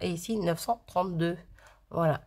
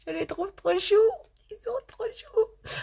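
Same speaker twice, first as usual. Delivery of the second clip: very high, squeaky-cute cooing; 13 semitones higher than usual. Real, not acted. Vlogger melting over cute stamp figures, gushing high baby talk. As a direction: coo it high, drawing out the end. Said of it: "Oui, je déraille".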